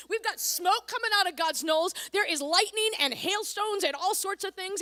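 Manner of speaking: ordinary talk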